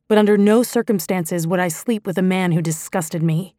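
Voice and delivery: usual speaking voice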